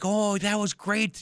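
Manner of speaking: high, drawn-out impressed cheer; imitated praise. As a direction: coo praise at a little kid, stretched and high. Older boys praise little kid's catch.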